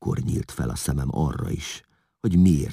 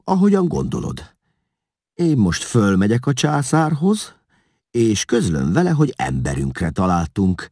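a hint of an eager, scheming courtier, pitched up at times; lightly voiced courtier; 5 semitones higher, 6 dB louder than usual. A narrator's reading. Krizafiosz answering Edekon with an evening invitation, lightly eager. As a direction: lightly play the courtier approving a plan.

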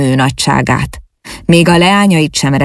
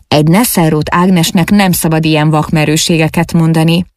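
ordinary delivery, nothing marked

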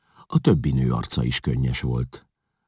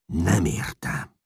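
growled; 4 semitones higher, much slower than usual. A narrator's reading. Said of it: "mordult meg Csát".

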